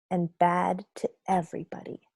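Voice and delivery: plain reading voice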